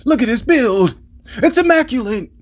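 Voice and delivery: high, awed anime character voice; anime inner monologue. Retold or imitated. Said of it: "inner thought of an anime".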